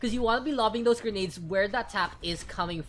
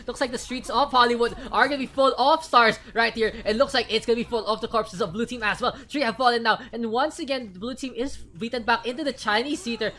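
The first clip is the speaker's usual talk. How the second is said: loud, excited esports call; hype casting; 3 semitones higher, 6 dB louder than usual. Acted. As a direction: build the hype as the team falls. Hype play-by-play.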